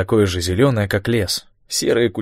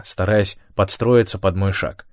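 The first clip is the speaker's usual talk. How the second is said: ordinary delivery, nothing marked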